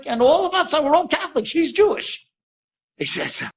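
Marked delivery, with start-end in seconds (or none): 0.1-2.1 high-pitched, emphatic retold revelation; incredulous revelation. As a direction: voice jumps way up, as if it's absurd.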